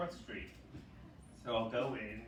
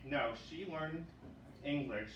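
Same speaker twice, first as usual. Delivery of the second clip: ordinary delivery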